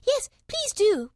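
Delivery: high, slow plea; pleading. A narrator's reading. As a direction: beg them sweetly. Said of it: "pleaded Alice".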